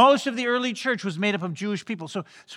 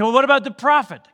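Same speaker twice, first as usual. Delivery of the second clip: louder, higher follow-up question; pressing questioner; 6 semitones higher, 6 dB louder, faster than usual. Acted. Leaders trying another guess on John, pressing. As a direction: push on with the next guess, voice raised.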